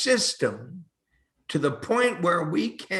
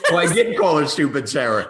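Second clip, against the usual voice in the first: laughing through the admission, quick and louder; 6 dB louder, faster than usual. Real, not acted. Guest laughing as he admits the nickname, laughing. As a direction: chuckle it out as you confess.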